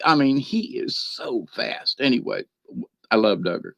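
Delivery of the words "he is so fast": hushed aside. Real, not acted. Marveling at the dog's speed.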